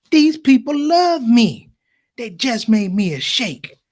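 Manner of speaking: slow, emphatic mock pride; self-important delight. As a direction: savor each word as the newly adored sheikh. Friend revels in being made sheikh.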